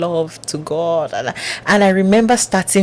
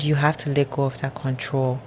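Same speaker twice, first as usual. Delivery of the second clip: much softer, hushed voice of God; God's calm voice; 7 dB quieter than usual. Retold or imitated. God's voice quoted.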